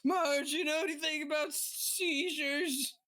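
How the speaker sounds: high, slow tearful wail; panicked plea. Acted. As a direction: plead in panic for help from a companion. Panicked character asks companion for help.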